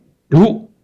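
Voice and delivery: loud, high 读 snapped; an angry parent commanding 读. Shown as a demonstration. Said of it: "So you get very angry, you say".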